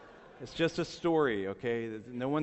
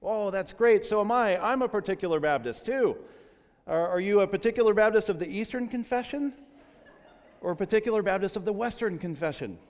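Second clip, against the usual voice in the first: high, loud acted enthusiasm; hammed-up delight, pitched high; overjoyed rescuer; 8 semitones higher, 5 dB louder than usual. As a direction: gush with mounting excitement. Rescuer's escalating delight in joke.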